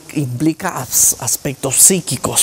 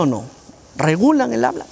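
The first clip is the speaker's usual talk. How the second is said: usual speaking voice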